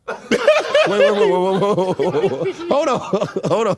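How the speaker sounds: high, laughing pleas to wait. Real, not acted. Host trying to restart the interview, laughing hard. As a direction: beg for a pause while cracking up.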